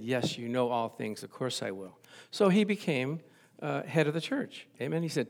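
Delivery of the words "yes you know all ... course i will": lower, much softer humble answer; humble quiet yes. Retold or imitated. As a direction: give in gently, nearly under breath. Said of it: "And he said".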